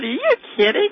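high-pitched incredulous question; disbelief. Retold or imitated. Mother to her son about the schoolmate, disbelief. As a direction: react in mock shock.

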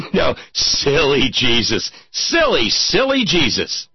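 high, slow, exaggerated dismissal; mock scoffing. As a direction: scoff playfully at a silly notion, drawing it out. Mocking the idea of confessing.

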